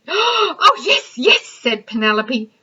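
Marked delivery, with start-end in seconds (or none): 0.1-1.4 high, eager yes yes; thrilled. Acted. Penelope thrilled at her find, high eager cry. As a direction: bubble over with delight.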